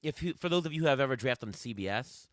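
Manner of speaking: ordinary delivery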